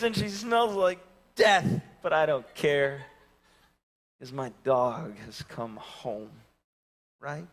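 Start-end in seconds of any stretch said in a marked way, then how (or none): none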